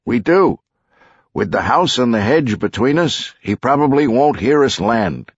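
pilot. Acted. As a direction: reassure the passengers about the landing.

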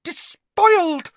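loud, very high, drawn-out horror; screeching librarian's fury; hissed outrage. A narrator's reading. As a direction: spit it out, scandalised. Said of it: "she hissed".

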